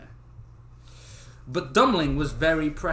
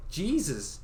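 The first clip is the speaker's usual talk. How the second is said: usual speaking voice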